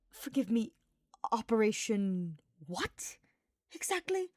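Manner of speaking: disbelieving, rising on exactly; incredulous. Acted. Hubert incredulous.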